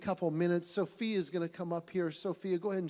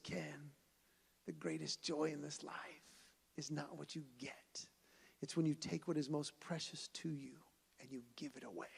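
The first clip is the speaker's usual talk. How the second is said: much softer, near-whispered message; God speaking gently to Ken, softer and hushed; reverent hush; 2 semitones lower, 11 dB quieter than usual. Retold or imitated. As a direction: hush it like a sacred secret. God's voice quoted reverently.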